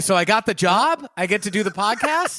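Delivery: a light, teasing gush of pretend excitement; mock excitement. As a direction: ham up the joy of being hired. Mock thrilled at audition verdict.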